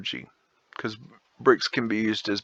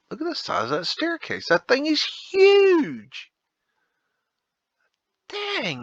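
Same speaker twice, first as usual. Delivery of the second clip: much higher, amazed at the size; awed exclamation; 3 dB louder than usual. Real, not acted. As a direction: gasp it out in wonder. Awed by a huge staircase photo.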